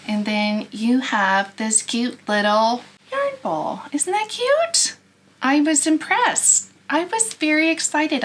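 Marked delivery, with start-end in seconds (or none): 3.1-8.2 excited. Real, not acted. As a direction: gush over it, thrilled. Showing off finished yarn bowl.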